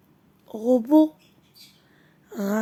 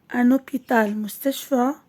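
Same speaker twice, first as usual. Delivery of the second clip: usual speaking voice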